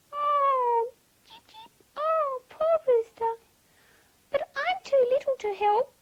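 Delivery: high, piping chick voice with tiny cheeps; high-pitched chick, full of pity; little chick. A narrator's reading. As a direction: squeak in dismay like a baby bird. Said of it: "said little chick".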